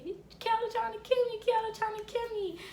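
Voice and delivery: very high, loud sing-out; mock cry for help. Child calling out that Kelly is after them, high mock alarm. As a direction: squeal it out in a mock panic, held high.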